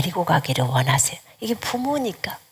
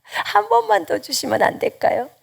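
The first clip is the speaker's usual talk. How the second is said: coaxing plea, pitched much higher with a laugh; pleading; 9 semitones higher than usual. Retold or imitated. Mother begging God for one more sign, coaxing and laughing. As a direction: beg sweetly once more, laughing.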